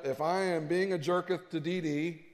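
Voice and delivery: ordinary delivery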